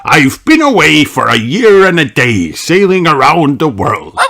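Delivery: put-on proud old sailor; lightly voiced sea captain. A narrator's reading. Captain Dog telling family he is home for good, hearty voice. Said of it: "he begins".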